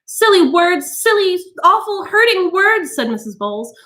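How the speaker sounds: high, righteous scolding; vindicated outrage at poetry; high accusing tirade; indignant. A narrator's reading. Mrs Bowles voiced.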